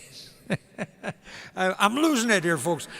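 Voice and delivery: normal talking voice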